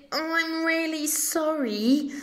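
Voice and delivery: lightly voiced shy baby Tyrannosaurus; timid baby dinosaur. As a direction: sound sweet and sorry. Gentle baby dinosaur refuses meat.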